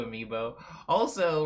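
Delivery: ordinary delivery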